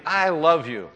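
loud high cry of love; screamed. Retold or imitated. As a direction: cry it out at full voice. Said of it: "he screamed".

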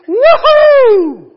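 huge high "woohoo" shout; joyful whoop. Acted. Preacher acts out the unborn baby's reaction, joyful whoop. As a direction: whoop like a baby leaping for joy. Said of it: "in his mother's womb and goes".

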